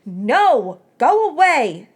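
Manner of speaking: loud, high shout to be left alone; angry shout. A narrator's reading. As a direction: yell him off. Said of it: "Melody yanked it away".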